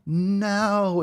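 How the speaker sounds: normal talking voice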